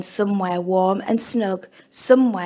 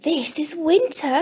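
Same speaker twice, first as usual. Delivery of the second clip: higher, amazed little bird; gasped; 7 semitones higher than usual. Acted. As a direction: breathe it out in wonder. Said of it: "gasped Robin".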